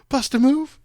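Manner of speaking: a hammed-up starstruck squeal; mock lady. Retelling the fan's greeting, mock high squeal. Retelling the lady's line again.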